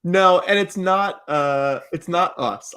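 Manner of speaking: ordinary talk, nothing marked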